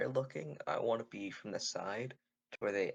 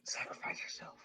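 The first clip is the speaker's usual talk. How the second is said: soft, whispered urging; whispering; 5 dB quieter than usual. Acted. Player urges companion out of hiding, whispering. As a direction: hiss it secretly to a companion.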